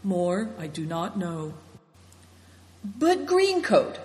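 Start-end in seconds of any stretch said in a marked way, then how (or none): 2.8-4.0 slow, stubborn question pitched much higher; obstinate. A narrator's reading. A stubborn guest pressing the elder about the thief, obstinately. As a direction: dig in and press the point. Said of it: "asked Nidong obstinately".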